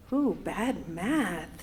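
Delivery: drawn-out wince at your own slip; slow, low "oops" to yourself; self-correcting aside. Real, not acted. Aside correcting her own mistake.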